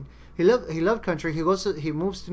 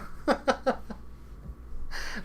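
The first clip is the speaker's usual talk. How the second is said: long breathy burst of laughter; 9 semitones higher, 6 dB quieter than usual. Real, not acted. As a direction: laugh at your own joke, trailing on. Laughing after own mock quote.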